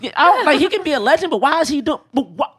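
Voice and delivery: high, loud indignant why. Real, not acted.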